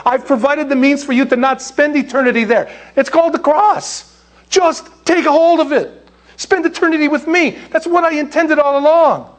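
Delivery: a loving father offering a way out; God pleading gently. Retold or imitated. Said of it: "Because God says".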